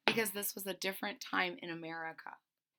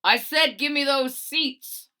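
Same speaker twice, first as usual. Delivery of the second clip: booming bus driver shout, slow and much louder; bellowing; 6 semitones higher, 10 dB louder than usual. Acted. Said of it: "the bus driver bellowed".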